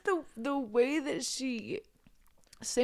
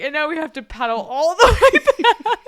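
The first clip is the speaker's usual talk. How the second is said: mock exasperation; 3 semitones higher, 13 dB louder, faster than usual. Sister's complaint retold.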